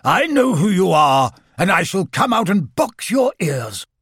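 shouting. A narrator's reading. Owl threatening someone unseen outside, shouting. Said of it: "and then he shouted".

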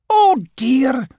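high, loud flustered cry; beginning panic. A narrator's reading. Rabbit faced with too many questions, starting to panic. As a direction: blurt it out, pitch leaping up. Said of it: "beginning to panic".

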